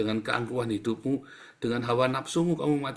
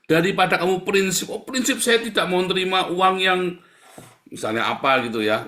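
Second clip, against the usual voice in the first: higher, louder mock of a stubborn person; loud mocking quote; 8 semitones higher, 8 dB louder than usual. Preacher loudly mocking a congregant's pride. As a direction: ridicule the stiff principle.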